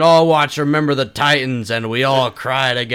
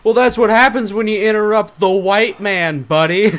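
higher, louder Kramer jeer; Kramer quote; 8 semitones higher, 6 dB louder than usual. Retold or imitated. Kramer taunting the heckler, higher and louder. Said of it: "when he's like".

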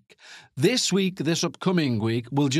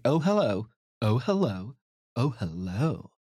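suggestive hello, sinking lower each time; mock kinky greeting; 4 semitones lower than usual. Podcaster greeting an overdue upload, mock-kinky and dropping lower. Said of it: "I don't get that kinky with it".

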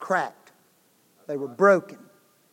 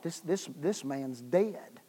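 hushed thought; 4 semitones lower, 11 dB quieter than usual. Retold or imitated. Recalling shock at finding body.

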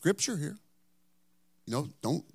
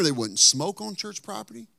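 ordinary talk

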